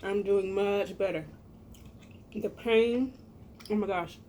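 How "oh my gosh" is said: normal talking voice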